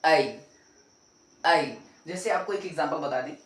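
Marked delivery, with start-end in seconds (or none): 0.0-1.8 "आई" said louder, clipped as ai; right letter sound. Shown as a demonstration. Teacher correcting the letter name to a firm ai. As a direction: hit "आई" firmly. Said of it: "ए नहीं होता".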